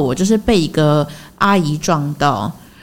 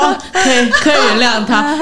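laughing through it; 4 semitones higher than usual. Real, not acted. Laughing while recalling.

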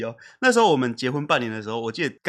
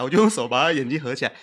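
usual speaking voice

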